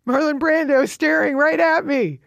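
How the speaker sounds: high, awed gasp of a line; high awed disbelief; stunned. Retold or imitated. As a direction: caught off guard by his stare. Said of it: "I look up and I'm like".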